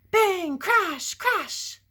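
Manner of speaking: high, loud bangs and crashes. Acted. Reader voicing the pig's crashing, loud bangs. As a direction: burst out with each crash. Reading crash noises aloud.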